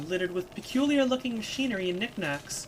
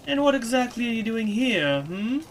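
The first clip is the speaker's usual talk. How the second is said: raised, louder crone's challenge; inquisitive crone; 5 semitones higher, 5 dB louder than usual. Acted. Crone catching intruders from the shadows, pointedly inquisitive. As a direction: question intruders like a nosy old crone.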